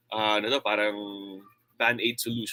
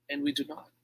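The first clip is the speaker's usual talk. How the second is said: softer contrast demo; 3 semitones higher, 7 dB quieter, faster than usual. Shown as a demonstration. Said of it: "I can compare and contrast".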